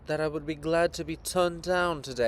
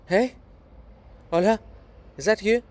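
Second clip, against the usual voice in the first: startled call; 6 semitones higher, 7 dB louder than usual. Acted. Startled, calling out to visitor.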